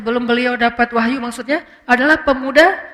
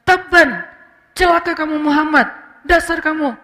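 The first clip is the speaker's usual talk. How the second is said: higher, louder furious outburst; Abu Lahab cursing; 5 semitones higher, 4 dB louder than usual. Acted. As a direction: spit out the curse in rage. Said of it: "Abu Lahab ngomong ... mengatakan".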